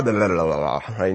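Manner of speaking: low, quick, dismissive blah blah; mumbled blah blah. Pretend. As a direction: toss the words off like skipping them. Skimming over a word.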